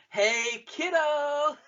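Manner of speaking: high, loud, cheesy yell; Chandler-like mock shout; goofy kiddos voice. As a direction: bellow it like a hammy trooper. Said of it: "what's that voice you're doing".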